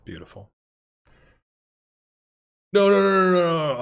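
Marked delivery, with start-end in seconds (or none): 2.7-3.8 rising high, loud protest no; real panicked cry of no; dismayed no. Real, not acted. Sudden mistake in game.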